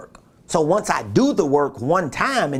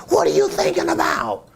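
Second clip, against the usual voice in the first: high mock yell of an angry bar boss; imitating a bar owner yelling at staff, high mock shout; imitated yelling boss. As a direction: bark it like a furious boss, pitched way up. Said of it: "he yell at everybody".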